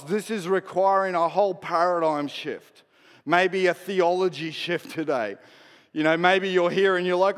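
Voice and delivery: ordinary talk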